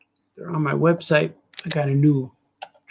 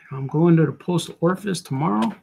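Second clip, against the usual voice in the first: usual speaking voice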